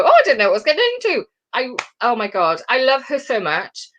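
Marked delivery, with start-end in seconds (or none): 0.0-1.2 very fast, higher mock excuse; flustered excuse. Imagined guest pulling out at the last minute, flustered excuse.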